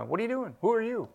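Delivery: high, soft quoted questions; retold questioning. Retold visitors questioning the inmate.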